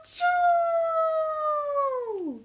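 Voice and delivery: long, loud, high comic sneeze; huge sneeze. Acted. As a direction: let out an enormous sneeze.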